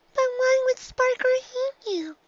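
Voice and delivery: high little filly voice; a small girl pressing her mother; high-pitched child pony insisting; young filly. A narrator's reading.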